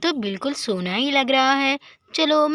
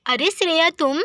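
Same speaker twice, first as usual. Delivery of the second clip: ordinary delivery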